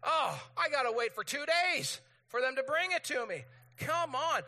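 high, loud mock complaint; hammed-up impatience at waiting; exasperated. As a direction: groan it out as an exasperated customer. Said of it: "You're like".

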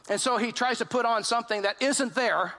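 usual speaking voice

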